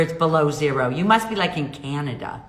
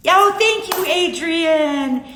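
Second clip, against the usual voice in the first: excited thanks; 11 semitones higher, 5 dB louder, much slower than usual. Real, not acted.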